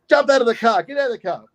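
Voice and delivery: high-pitched mock command; policeman's order. Policeman telling the driver to get out, mock command. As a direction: imitate a cop ordering the driver out. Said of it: "this big native policeman goes".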